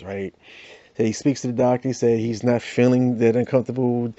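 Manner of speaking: usual speaking voice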